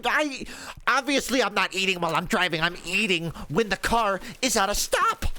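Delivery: high, fast, defensive mock voice; imitating Glenn. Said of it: "He's like".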